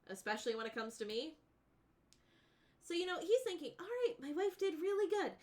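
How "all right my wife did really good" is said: high, loud excited husband; eager husband. Retold or imitated. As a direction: gush like a relieved husband.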